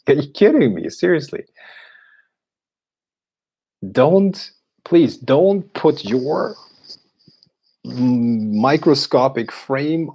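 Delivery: high, loud exasperated pleading; begging them to stop, voice shooting way up; exasperated disbelief. Real, not acted. Exasperated rant at viewer.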